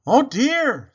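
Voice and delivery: high, loud oh dear; dismayed. A narrator's reading. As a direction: cry out in dismay. Baby reacts to crash.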